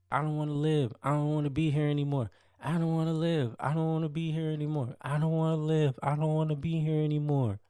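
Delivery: high pleading wail, imitating her; hammed-up suicidal plea, pitched high; mock desperate screaming. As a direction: beg in a high mock-hysterical voice. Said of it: "screaming this in the hotel room".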